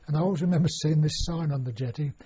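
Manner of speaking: usual speaking voice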